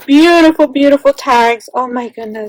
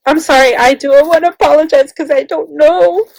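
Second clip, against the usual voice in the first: normal talking voice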